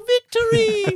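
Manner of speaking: high, loud "Victory!" yelled while laughing; hammed-up victory squeal; mock triumphant cry. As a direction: shriek it like a departing soul.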